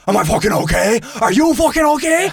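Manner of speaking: indignant meth head, pitch climbing high; acted-out furious comeback, rising shrilly; retold angry yelling. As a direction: snap back as an outraged stranger. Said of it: "he starts yelling at my buddy".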